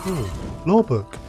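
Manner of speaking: normal talking voice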